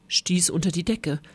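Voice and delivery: plain reading voice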